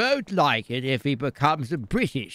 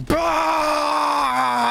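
put-on sudden high, loud old-man "bah!"; a cranky outburst from a bed-bound old man; old man shout; 10 semitones higher, 4 dB louder than usual. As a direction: blurt it out like a flustered geezer. Confused old man blurts out.

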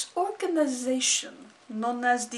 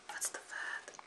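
whispered; 16 dB quieter than usual. Acted. Searching for a word.

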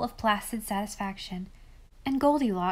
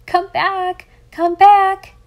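high, very loud screaming call; 10 semitones higher, 15 dB louder, slower than usual. A narrator's reading. Boys on the fence screaming after the departing girl. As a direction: shriek it after her like a flock of birds. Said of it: "screaming like a flock of guinea fowls".

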